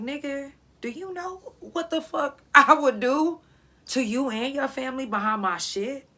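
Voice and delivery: high, slowing menacing threat; retold threat. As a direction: lean in and threaten him.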